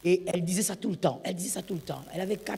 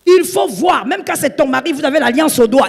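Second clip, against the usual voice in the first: loud, high pulpit exhortation; urgent warning to wives; full-force preaching; 7 semitones higher, 10 dB louder than usual. Acted. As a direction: thunder it at the congregation.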